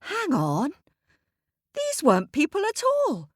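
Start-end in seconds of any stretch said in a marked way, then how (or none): 1.7-3.3 surprised. A narrator's reading. Surprise at seeing animals.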